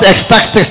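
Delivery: "ecstatic" garbled with extra s and t sounds; mispronounced. Shown as a demonstration. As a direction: stumble through "ecstatic" as ex-tak-stis-tis. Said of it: "Not ecstatic".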